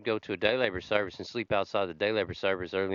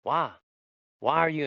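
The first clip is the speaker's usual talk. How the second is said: mild retold shock, slightly raised pitch; freaked-out disbelief; 5 semitones higher than usual. Boss learns his worker is homeless, retold freaked-out disbelief. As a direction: imitate someone taken aback, asking why. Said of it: "like, freaked out".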